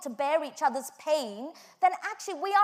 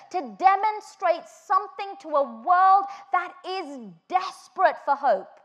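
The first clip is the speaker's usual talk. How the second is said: loud, high, slow emphatic preaching; full-force preaching; 5 semitones higher, 8 dB louder than usual. Acted. Preacher rallying the congregation, loud and slow. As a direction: drive it home to the congregation.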